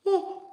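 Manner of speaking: high yawning oh. Real, not acted. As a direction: let out a real yawn. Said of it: "so I'm yawning today".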